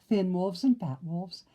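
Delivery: ordinary delivery, nothing marked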